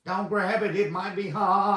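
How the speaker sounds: high, anxious grandma warning; warning grandkids. Retold or imitated. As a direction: warn the kids like a fretting grandma. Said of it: "the first thing she tells the grandkids".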